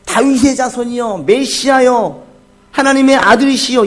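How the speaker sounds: high, loud drawn-out call to the Messiah; crying out. Retold or imitated. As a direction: cry out fervently to be heard. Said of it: "바디메오가 외칩니다".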